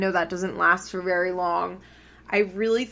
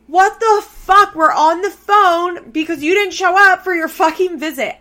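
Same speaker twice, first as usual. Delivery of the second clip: much higher, much louder furious scream; imitating Kayla screaming; 11 semitones higher, 11 dB louder than usual. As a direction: explode at him at full volume. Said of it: "And Kayla's like".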